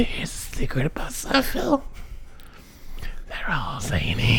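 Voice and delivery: low secretive whisper. Acted. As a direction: let them in on a secret. Revealing the secret.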